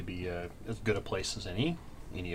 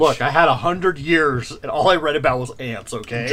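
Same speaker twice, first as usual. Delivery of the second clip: louder, insistent defence; emphatic; 5 semitones higher, 10 dB louder than usual. Real, not acted. Player defending his ant lore to the GM, louder and insistent. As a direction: push back hard, half joking.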